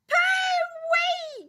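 very high, loud squeal; disgusted gasp. A narrator's reading. Doctor Duck reels from gorilla's smell, gasping squeal. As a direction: squeal it out at a terrible stink. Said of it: "gasped Doctor Duck".

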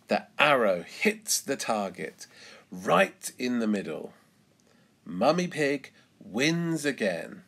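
plain reading voice

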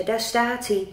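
ordinary delivery

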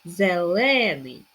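"Зелений" slow, loud on stretched ле; "Зелений", leaning hard on the middle syllable; stress on second е. Shown as a demonstration. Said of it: "Наголос на другу е".

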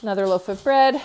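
ordinary talk

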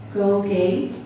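usual speaking voice

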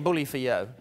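sarcastic. Retold or imitated. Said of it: "You think".

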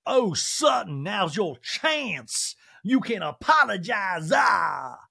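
high, very slow, shouted put-on; dramatic Sutton. Host imitating Sutton's dramatic kitchen scene, high and shouted. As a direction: sweep through it grandly and slowly.

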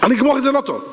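high, acted angry warning; threatening father. King warning his wayward son, threatening. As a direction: raise the pitch on a stern threat.

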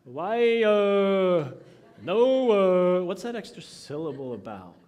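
mock a whining teenager, stretching the syllable; hammed-up teen whining; whiny teenage girl. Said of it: "put an extra syllable when they're whining".

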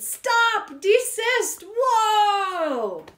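very high, loud shouts; shouted. Acted. Commanding the Yippee Yuck to let go, shouting. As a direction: bellow at the creature. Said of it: "I shouted".

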